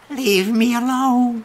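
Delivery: high, slow, loud English wail; high drawn-out English cry; girl's shrill plea. Retold or imitated. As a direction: wail it like the sick girl pushing him off. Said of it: "qu'elle me fait comme ça".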